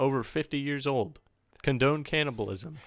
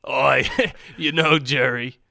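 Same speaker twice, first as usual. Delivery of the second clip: loud, laughing Australian Kramer voice; Australian accent; 3 semitones higher, 7 dB louder than usual. Pretend. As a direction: do Kramer as an Aussie bloke. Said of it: "can I get a little bit of audio of, uh, Australian Kramer".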